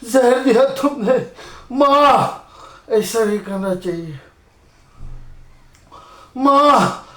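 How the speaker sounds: barely holding on as life slips; weak dying voice. Acted. Dying son pleading faintly with mother.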